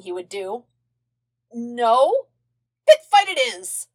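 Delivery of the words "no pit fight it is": loud, high cackling king. A narrator's reading. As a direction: cackle it out gleefully. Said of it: "the king cackled".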